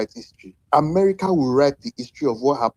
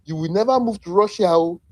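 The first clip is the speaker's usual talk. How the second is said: ordinary talk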